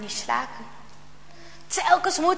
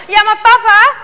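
put-on higher, much louder boy's protest; 6 semitones higher, 11 dB louder than usual. A narrator's reading. Jarno protests to his father, loud and high. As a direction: cry out against father.

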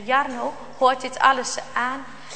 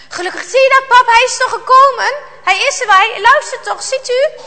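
put-on much higher, louder, eager boy; relieved excited boy; 8 semitones higher, 7 dB louder than usual. A narrator's reading. Jarno shows father the dog came, eager and excited.

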